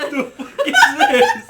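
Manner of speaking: shocked disgust. Retold or imitated. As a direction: shriek it in laughing disbelief. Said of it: "I was like".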